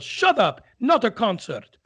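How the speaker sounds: sharp scolding call; retold yell. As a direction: imitate a maestro silencing the audience. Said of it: "and he yells".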